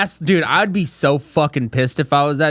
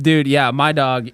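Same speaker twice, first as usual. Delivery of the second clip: ordinary talk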